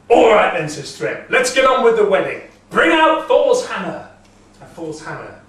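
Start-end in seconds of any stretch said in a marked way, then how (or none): 0.1-4.1 eager giant. Acted. Thrym eagerly orders the wedding.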